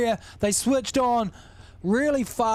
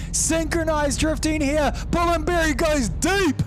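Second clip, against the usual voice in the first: high, urgent race call; live play-by-play; 9 semitones higher, slower than usual. Real, not acted. Commentator calling the third-place battle live, excited play-by-play. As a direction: call every move as it happens.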